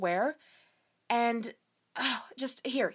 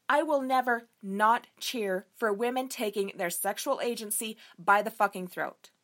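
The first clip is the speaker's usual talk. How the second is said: ordinary talk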